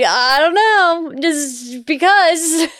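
put-on high, slow sulky kid shrug; mother voicing her five-year-old's lame excuse. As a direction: drag it out like a stubborn child. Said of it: "our five-year-old".

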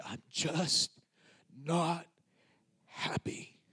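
halting and hushed, fading to a whisper; retold confession, long pauses between words; on verge of bawling. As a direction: force it out quietly before the tears. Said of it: "I just began to bawl".

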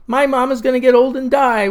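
a little boy wailing in mock despair. Boy wails to the storyteller about his mama, hammed-up wail. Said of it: "wailed Tyler Post Croydon".

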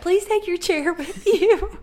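high, loud, drawn-out announcement breaking into laughter; mock pastor. Pastor telling wedding guests to take their chairs, mock announcement with a laugh. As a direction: announce it slowly as a joking pastor. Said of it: "the pastor will be like".

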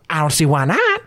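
a hint of an old frontier prospector; folksy old miner greeting. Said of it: "an old prospector".

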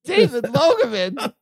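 high questioning name; amazed. Retold or imitated. Repeating the drummer's name, high and amazed. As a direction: squeak the name in wonder. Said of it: "I was like".

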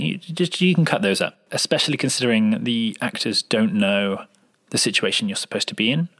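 ordinary talk, nothing marked

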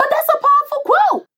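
high, loud, squeaky protest of her own quote; mock-offended defense. Real, not acted. Defending her quote to the teasing co-host, squeaky and loud.

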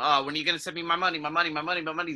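fast, pleading mock repeat of "my money"; whiny mock begging for pay; mocking plea. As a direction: rattle it off like a nagging pest. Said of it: "keep asking them".

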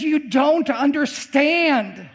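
"You don't understand" high and drawn out, each word weighted; slow emphatic insistence. Retold or imitated. Professor insists to the preacher, "don't understand" slow and emphatic.

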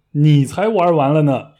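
put-on a touch of an offended old man snapping back; angry retort. Grandpa offended at being asked if he's done for, mildly irritated. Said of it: "马上就生气地说".

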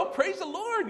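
high, sing-song churchy delight; mock cheerful praise. Preacher imitating a woman cheering a broken dish. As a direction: chirp it like a beaming grandma. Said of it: "She'd go".

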